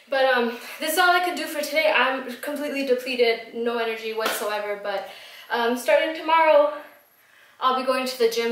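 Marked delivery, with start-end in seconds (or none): none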